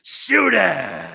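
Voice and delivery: Shooter McGavin voice. Retold or imitated. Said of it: "And he goes".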